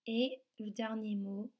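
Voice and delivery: usual speaking voice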